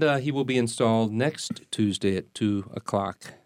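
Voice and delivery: ordinary talk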